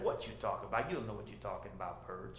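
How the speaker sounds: low, fast Cajun scoff; cajun accent. Acted. Burglar scoffs at the parrot.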